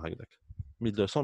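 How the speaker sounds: ordinary talk, nothing marked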